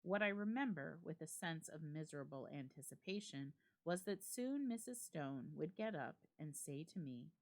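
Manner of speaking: usual speaking voice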